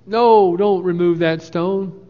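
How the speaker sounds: Martha's protest. Acted. Martha begs Jesus not to open the tomb, loud protest. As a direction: Martha pleading against moving the stone.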